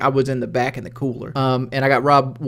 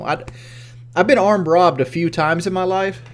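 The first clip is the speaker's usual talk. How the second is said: normal talking voice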